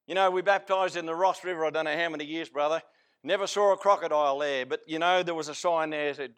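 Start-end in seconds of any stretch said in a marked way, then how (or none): none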